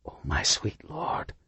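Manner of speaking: soft, slow, hushed awe; disbelieving whisper. A narrator's reading. Officer whispering in disbelief at the mob. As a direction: breathe it out in disbelief. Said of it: "in a disbelieving whisper".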